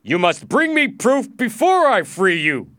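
high, loud insistence; overbearing dealer's shout; domineering slave dealer. A narrator's reading. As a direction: lay down the law loudly. Slave dealer refuses loudly.

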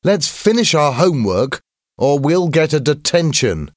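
high, animated schoolboy voice; schoolboy character. A narrator's reading. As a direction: voice an earnest boy, pitched well up. Schoolboy voiced in narrated story.

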